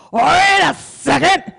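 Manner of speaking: a long, loud, high Wait a second; drunk shout. Retold or imitated. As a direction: yell while rushing at closing doors. Drunk businessman yelling.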